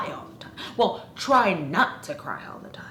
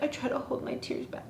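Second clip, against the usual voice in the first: higher, hushed, quick mock-weepy voice; breathy higher mock sob-voice; mocking whiny Effie; 6 semitones higher, 8 dB quieter, faster than usual. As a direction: parody a crybaby holding back tears. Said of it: "then she's like".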